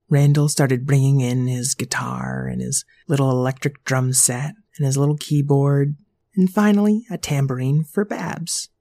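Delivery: usual speaking voice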